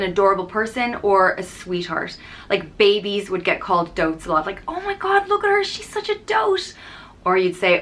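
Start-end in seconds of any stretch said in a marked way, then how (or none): 4.7-6.7 put-on high, gushing coo at a baby; cooing over a baby. Mother cooing over a baby, high gushing voice. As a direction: melt over the little one.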